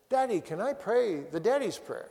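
a hint of a little boy asking his daddy; three-year-old son. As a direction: pitch it up slightly like a young child asking. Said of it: "Calvin was sitting on my lap as a three-year-old".

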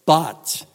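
"But" punched loud, standing alone; strong contrast. Retold or imitated. Preacher stressing the strongest contrasting conjunction, "But" said forcefully. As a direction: hit "But" hard, then let it hang. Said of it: "that is the strongest contrasting conjunction".

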